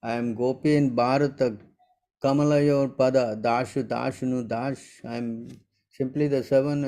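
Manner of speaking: usual speaking voice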